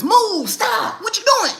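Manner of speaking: high loud yell; overstimulated yell. Retold or imitated. Overstimulated mom yelling at clingy kids. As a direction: shriek it, fed up. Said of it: "Kids love to overstimulate us".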